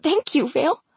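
high, tearful thank-you; voice breaking. A narrator's reading. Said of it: "my voice breaking".

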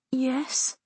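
put-on high, soft child's voice; little brother, hushed and high; small boy. A narrator's reading. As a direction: answer small and sleepy. Sleepy young brother answers.

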